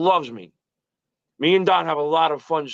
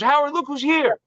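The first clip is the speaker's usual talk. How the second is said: higher, put-on showman voice; imitating Don; 10 semitones higher than usual. Agent presenting old friend to Howard, high mock fanfare. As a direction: announce the surprise guest, pitched up. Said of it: "He goes".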